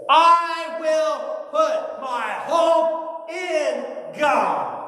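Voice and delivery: high, slow ringing proclamation; declaring hope. Acted. Psalmist answering his own lament, ringing out high. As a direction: cry out the answer of hope, drawn out. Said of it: "he answers it this way".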